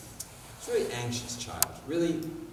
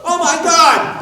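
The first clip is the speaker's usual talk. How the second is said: loud, high, frantic parent cry; hammed-up alarm at the child's fall; mock panicked parent; 13 semitones higher, 17 dB louder, slower than usual. Parent in the stands sees child fall, loud panicked cry.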